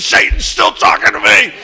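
high, loud, breath-heavy spooky voice; spooky growl. Retold or imitated. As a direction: hiss it out like a haunted man. Andy in back seat.

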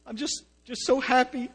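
hammed-up happy crying; imitating crying. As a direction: choke it out like a weepy newcomer. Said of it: "they're just crying".

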